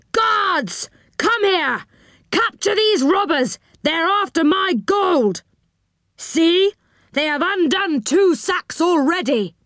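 high-pitched, outraged summons; enchanter shouting orders. A narrator's reading. Enchanter calls guards to his gold, shouting. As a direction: cry out for help against thieves. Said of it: "shouted the enchanter in a loud voice".

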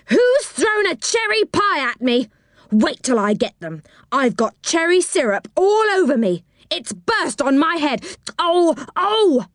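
high-pitched outraged yell; very angry yell. A narrator's reading. Pie victim yells up the tree at whoever threw it, very angry yell. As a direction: yell it furiously, climbing high on the complaints. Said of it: "came a very angry yell".

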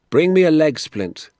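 high, loud order; brisk command. Acted. Doctor to ambulance men, calling out a command.

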